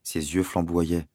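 ordinary delivery

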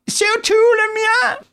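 high-pitched squeaky companion; thin high character voice; Wilson, injured sidekick. Acted. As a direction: pipe up in a high, put-on voice.

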